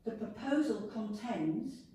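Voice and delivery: usual speaking voice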